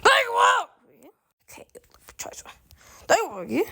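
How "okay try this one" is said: soft whispered coaxing; whispering. Real, not acted. Whispered offer to child.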